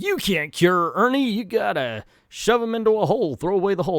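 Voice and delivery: high, loud acted rant; hammed-up emphatic objection; dismissive doctor. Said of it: "he's immediately like".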